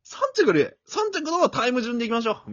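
high, loud, breezy resignation; imitating Oda Yuji. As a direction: give up on gold with cheerful gusto. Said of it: "みたいな". (織田裕二のまねで軽く諦める)

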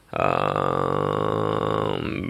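very low, slow beatbox bass drone; extremely deep bass voice. Shown as a demonstration. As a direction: sustain one deep rumbling bass note. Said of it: "これをちょっとビートボックスらしくして".

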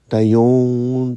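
loud, drawn-out cartoon catchphrase. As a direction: stretch it like a goofy cartoon. Quoting cartoon character.

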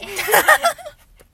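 high burst of laughter; loud laugh. Real, not acted. Laughing after grandma joke. (大笑い)

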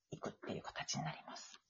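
trailing into a whisper. Real, not acted. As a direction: drop to a breathy whisper. Voice drops to whisper.

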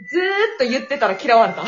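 loud laugh bursting through the words. Real, not acted. Telling friends she got disliked, laughing loudly. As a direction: crack up laughing mid-confession. Funny story of being disliked.

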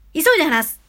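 quick demo of fast talking; fast speech. Shown as a demonstration. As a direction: rattle it off quickly. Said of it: "緩急というのは".

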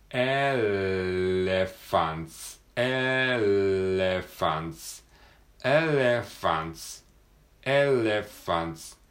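"エレファンツ" repeated slowly with a long L; exaggerated L drill. Shown as a demonstration. Teacher repeats "エレファンツ" slowly, stretching the L. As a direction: keep stretching the L in each "エレファンツ". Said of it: "大げさなぐらい長く".

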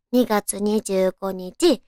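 normal talking voice

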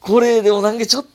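very high, loud, stretched-out words; excited swooning. Real, not acted. Swooning over the boyfriend's move. (大興奮で感心する)

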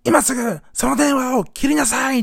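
imitating an older man's slow, high, loud command; retold indignant yell; shouted order. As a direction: bellow it high, stretching each word as a scolding stranger. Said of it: "おっさんが叫んでたの".

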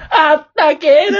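high, loud wailing holler; clumsy catchphrase shout. Pretend. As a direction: belt it out high, hammed-up and stretched. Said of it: "下手くそー。".

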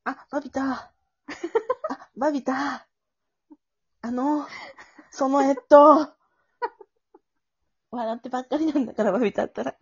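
put-on high, hesitant, bashful girl voice; shy girlfriend role. Jemi flustered before Babita's confession, high and bashful. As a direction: stammer shyly before the confession. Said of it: "私、ジェミーね".

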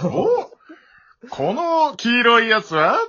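character impression. Acting out the ad-lib he blurted as the ogre, in a cartoon voice. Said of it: "つって言っちゃう".

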